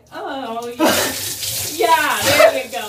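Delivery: loud high cry; cold shock shout. Real, not acted. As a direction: yell through the icy water. Ice water dumped again.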